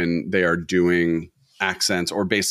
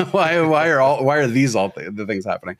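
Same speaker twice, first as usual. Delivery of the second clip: laughing, baffled pleading; mock bewildered protest through laughter; high laughing disbelief; exasperated why; 5 semitones higher than usual. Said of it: "You're just like".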